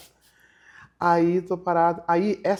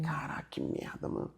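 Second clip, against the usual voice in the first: quiet frustration; 11 dB quieter than usual. Retold or imitated. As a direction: let it sink in, much softer. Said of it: "um lugar de frustração".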